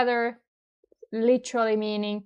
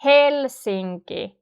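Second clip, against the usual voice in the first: "Helsinki" slowly, strongest on "Hel"; stress on first syllable; 2 semitones higher, slower than usual. Shown as a demonstration. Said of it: "it's the strongest".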